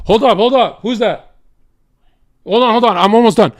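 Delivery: high, loud call to someone off; shouting toward the door to wait. Real, not acted. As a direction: call out to hold them off.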